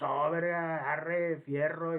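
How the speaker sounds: slow, loud Sinaloa drawl; northern Mexican accent. Retold or imitated. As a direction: imitate a norteño from Sinaloa. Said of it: "quieren hablar como norteños, como sinaloenses". (acento norteño)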